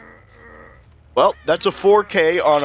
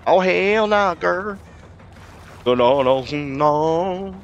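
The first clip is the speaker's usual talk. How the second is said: higher-pitched, playful sassy scolding; sassy put-on voice; 5 semitones higher, much slower than usual. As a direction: scold with mock attitude, drawing out the "uh-uh". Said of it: "I don't know why I'm talking like that".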